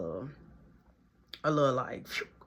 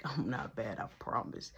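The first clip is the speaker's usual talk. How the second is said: whispered protest; 7 semitones lower, 12 dB quieter than usual. Retold or imitated. His whispered reply.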